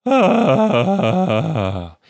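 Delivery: high, loud sigh breaking and tumbling down; a sigh that flips into a yodel; yodeling crazy sigh. Shown as a demonstration. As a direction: give up everything in one sigh. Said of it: "so try one more time".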